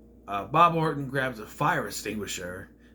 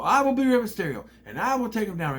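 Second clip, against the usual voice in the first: mock boastful heel; 9 semitones higher, 4 dB louder than usual. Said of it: "he's talking about".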